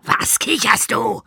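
put-on high, loud, slow snap at the boy; a gym teacher snapping at a giggling kid; snapping coach. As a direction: bark the question like an angry gym teacher. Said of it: "fuhr mich Kniescheibe an".

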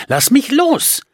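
high, shrieked demand to let go; struggling girl's shriek; angry cry. Acted. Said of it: "schrie Jenna".

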